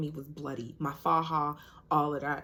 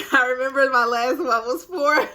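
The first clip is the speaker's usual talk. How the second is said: laughing; 7 semitones higher, 9 dB louder than usual. Real, not acted. Laughing at her own story.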